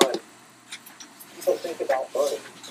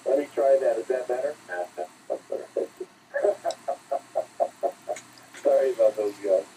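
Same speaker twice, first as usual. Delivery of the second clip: usual speaking voice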